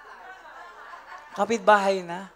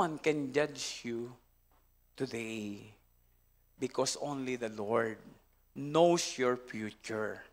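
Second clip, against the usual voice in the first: soft slow preaching; 7 semitones lower, 6 dB quieter, slower than usual. Real, not acted. Preacher drops low and slow.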